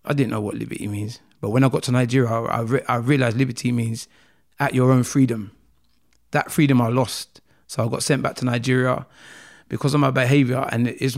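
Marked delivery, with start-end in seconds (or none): none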